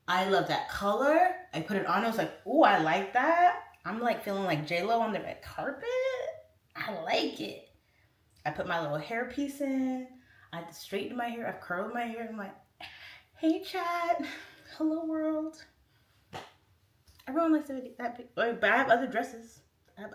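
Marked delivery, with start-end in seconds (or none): 0.1-1.3 loud, slow, drawn-out delight; gushing admiration. Retold or imitated. Shopper spotting a red dress in Hawaii, loud gushing. 2.5-3.6 loud, stretched-out ooh of approval; admiring delight. Retold or imitated. Trying on the red dress and loving it, loud delight. 13.4-15.6 high, sing-out hello; showy greeting. Retold or imitated. Dolled-up streamer greeting her chat, showy and high.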